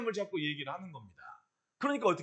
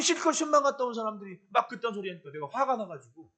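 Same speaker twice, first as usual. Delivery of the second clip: ordinary talk, nothing marked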